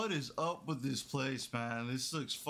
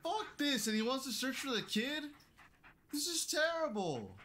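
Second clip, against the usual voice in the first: high-pitched, rattled complaint; really freaked out, pitched way up; spooked protest; 9 semitones higher than usual. Real, not acted. Spooked player protests at the game.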